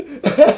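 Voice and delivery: giggling burst; laughing. Real, not acted. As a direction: laugh at your own joke. Laughing at own impression.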